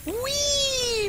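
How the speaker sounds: playful whee. Pretend. Caster cheers a unit.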